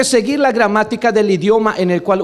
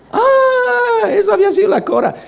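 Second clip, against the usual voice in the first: sudden surprise; 13 semitones higher than usual. Retold or imitated. Preacher retells his own realization.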